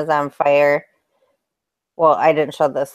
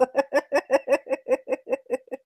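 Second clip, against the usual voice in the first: high giggle; 8 semitones higher, 7 dB quieter than usual. Real, not acted. Caught friend's reaction face.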